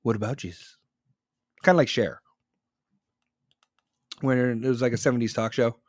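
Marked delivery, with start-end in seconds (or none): 0.0-0.8 imitating a snarky retort; snarky Oprah response. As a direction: throw it back dismissively, dropped low. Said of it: "Oprah's made the snarky response".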